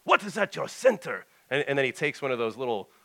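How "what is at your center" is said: put-on loud, high demand in a Russian accent; forceful, hammed-up Russian challenge; North. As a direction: interrogate him like a big Russian Cossack. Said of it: "up, up against the wall".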